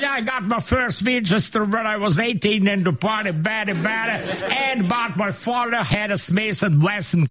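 put-on high-pitched, gushing old lady from America; old American lady. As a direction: play a tourist lady chatting in English. Acting the excited old American lady.

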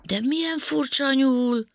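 high-pitched astonished exclamation; wide-eyed wonder, pitched high; amazed. A narrator's reading. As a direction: marvel at what you see. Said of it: "ámuldozott Panka".

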